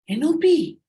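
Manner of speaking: loud, high exclamation of the letters; exclaiming letters. Shown as a demonstration. Teacher showing the class how an exclamation mark sounds, loud and high. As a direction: read the letters as if they were an exclamation. Alphabet read with punctuation expression.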